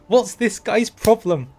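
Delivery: loud baffled outrage; exasperated outburst. Real, not acted. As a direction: throw up your hands, shouting at the screen. Outraged at repeated introductions.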